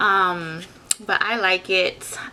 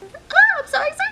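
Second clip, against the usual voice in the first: excited squeal; 6 dB louder than usual. Real, not acted. Squeals at new powder.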